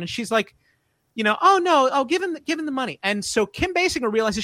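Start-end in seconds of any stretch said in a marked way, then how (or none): 1.4-3.0 high, fretting woman's voice; imitated actress. Girlfriend urges him to pay the kids, high voice. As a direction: plead lightly, pitched up. Acts actress in the shakedown scene.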